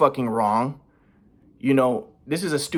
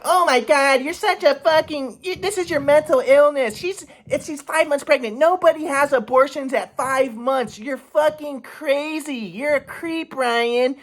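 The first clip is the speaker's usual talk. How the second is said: much higher, slow mock of mom scolding; 11 semitones higher, slower than usual. Son imitates mom scolding him, high mocking voice. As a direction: sneer it as his mom, pitched way up. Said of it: "my mom's like".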